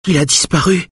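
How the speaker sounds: high, weak woman's voice; tearful plea. A narrator's reading. Wife tells husband of disappearance, high and tearful. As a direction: lift it high, close to tears. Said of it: "répète-t-elle".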